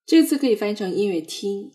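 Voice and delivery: ordinary talk, nothing marked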